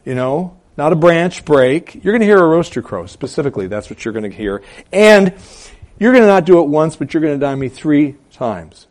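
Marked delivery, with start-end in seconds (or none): 0.8-3.1 high "branch break", then quick "rooster crow"; raised, insistent contrast on "rooster"; emphatic. Acted. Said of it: "He's emphatic about the sign". 4.9-8.9 high, loud "And", easing toward "three times"; forceful raised warning, stressing "three"; emphatic. Acted. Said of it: "Emphatically saying".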